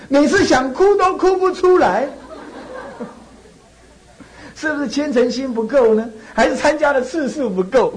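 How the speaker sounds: reading the question through laughter; amused reading, laughing through the words. Real, not acted. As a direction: chuckle while reading it out.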